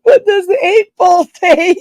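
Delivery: high, loud and breaking up laughing; real laughter. Real, not acted. Streamer cracking up over a chat question, laughing loudly. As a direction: squeal it out through helpless laughter.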